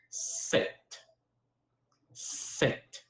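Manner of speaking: "Sept" with the T sounded at the end; French pronunciation. Shown as a demonstration. Teaching students seven in French, careful pronunciation. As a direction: say "Sept" as one short word with a silent P. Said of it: "You hear how I say it?".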